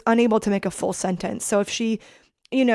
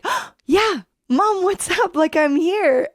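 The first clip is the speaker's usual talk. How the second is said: high, bright-eyed eager answer; eager reply to mom; 9 semitones higher, 5 dB louder, slower than usual. Retold or imitated. Daughter answering her mom eagerly, raised pitch. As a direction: perk right up and answer mom.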